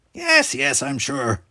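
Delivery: exasperated yes, yes; sighing, impatient reassurance; sighed. A narrator's reading. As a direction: brush him off wearily. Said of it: "Talos sighed".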